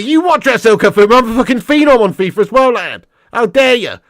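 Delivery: loud, high mock indignation; mock outrage. Streamer firing back at a viewer's FIFA jibe, mock outraged. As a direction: act hugely affronted, voice shooting up.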